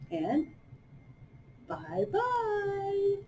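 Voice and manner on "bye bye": soft high bye-bye; soft little wave goodbye. Shown as a demonstration. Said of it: "two soft things we say".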